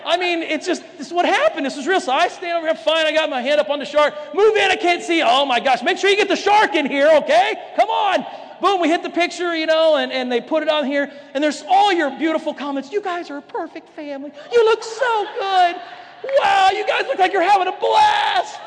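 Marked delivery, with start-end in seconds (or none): 4.3-8.3 high, loud, urgent; impatient photo orders. Retold or imitated. Mom directing the family photo, loud and impatient. 12.9-18.6 high, sugary over-the-top praise; mock gushing comments. Followers commenting on the family photo, mock gushing.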